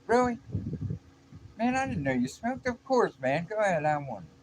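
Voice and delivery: high, loud acted-out surprise; amazed reply. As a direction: play the astonished friend, pitch way up.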